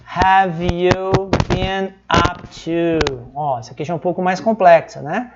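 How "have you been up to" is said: very slow, word by word; slow demonstration. Shown as a demonstration. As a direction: stretch out each word for learners to follow. Teacher models complex phrase slowly. (bem devagar, palavra por palavra)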